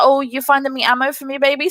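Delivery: a hint of a smug sexist gamer; condescending, fast. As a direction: patronise her in a hurry. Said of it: "really condescending".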